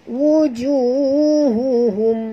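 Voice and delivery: slow chanted recitation; Quran recitation. Shown as a demonstration. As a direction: draw the word out as chant. Recites verse for class. (bacaan tartil perlahan)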